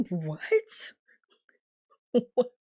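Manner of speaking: small, delayed, disbelieving what; mock stunned whisper. Imitating Keisha after the long pause, stunned and quiet. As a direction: imitate a dazed, barely voiced reaction.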